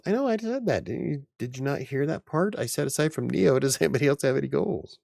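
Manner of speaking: playful indignation, pushed high and loud; defensive protest. Real, not acted. Defends self against chat's correction.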